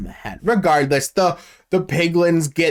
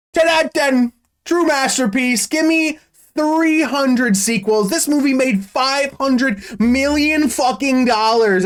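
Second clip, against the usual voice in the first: gushing praise; 8 semitones higher than usual. Real, not acted. Mock rave review.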